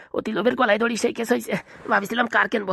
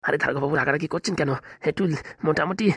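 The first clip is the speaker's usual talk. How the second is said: placating; 6 semitones lower, 4 dB quieter than usual. Acted. Doctor soothing angry officer.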